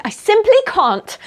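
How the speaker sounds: high, loud protest; emphatic refusal. Acted. Young lady refusing to go in without friends, emphatic. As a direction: protest earnestly, pitch jumping up. Acts her refusal to go alone.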